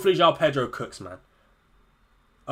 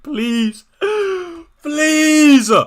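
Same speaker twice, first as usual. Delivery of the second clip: higher and louder begging cry; desperate plea; 12 semitones higher, 4 dB louder than usual. Real, not acted. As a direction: beg the heavens for it. Begging for a green arrow.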